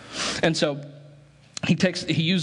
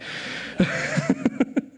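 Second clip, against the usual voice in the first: a quick unvoiced laugh at his own joke; short breathy laugh; 7 semitones higher than usual. Real, not acted. Pastor laughing at his own joke to the congregation, light airy laugh.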